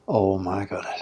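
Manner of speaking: low, soft sinking realization; understated, retold dismay; hushed dread. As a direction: imitate a quietly stunned reaction. Retold dread on hearing own sin.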